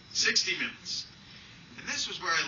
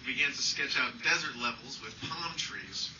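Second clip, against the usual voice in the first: ordinary talk, nothing marked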